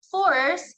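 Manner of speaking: emphatic teaching of Force; stressed Force. Shown as a demonstration. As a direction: Force punched high and loud. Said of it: "it shows the intensity or the loudness".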